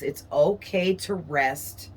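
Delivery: ordinary talk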